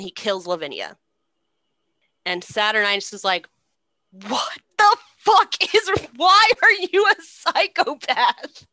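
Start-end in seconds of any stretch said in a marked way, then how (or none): 4.1-8.7 high, loud, appalled shouting; outraged yell. Acted. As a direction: yell it at someone who just did something insane. Saturninus reacts to Titus killing Lavinia.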